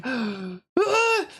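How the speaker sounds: high gasping cry of pain. Retold or imitated. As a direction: let out a mock cry of pain.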